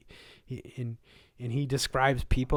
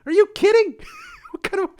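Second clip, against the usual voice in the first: incredulous outburst; 11 dB louder, faster than usual. Real, not acted. Ranting about women playing rugby.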